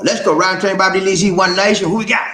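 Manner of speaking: high, loud roll call; host call-out. Real, not acted. Host asks the crew who's next, high loud call-out. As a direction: sing out the call for the next one.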